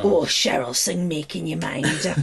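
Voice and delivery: low, lusting put-on voice; mock lecherous man. Teasing Dave about Cheryl, mock lecherous. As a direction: groan it low, as a smitten fan.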